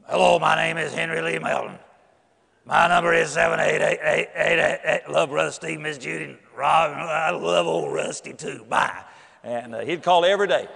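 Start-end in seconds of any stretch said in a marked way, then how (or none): 0.1-9.0 imitating Henry. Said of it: "he talked like".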